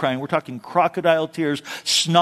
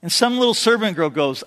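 normal talking voice

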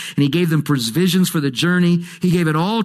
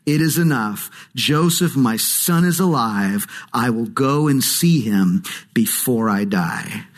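a hint of a slow old father; lightly voiced aged Jacob, lower and slow; 7 semitones lower than usual. Old Jacob hearing Joseph lives, lightly voiced and slow. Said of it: "Then Israel said".

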